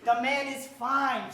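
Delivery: usual speaking voice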